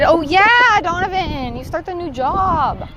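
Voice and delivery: excited congratulations. Real, not acted. Streamer cheering a viewer's new job, excited. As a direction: cheer them on, pitch leaping up on the yeah.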